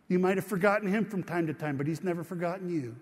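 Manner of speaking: ordinary delivery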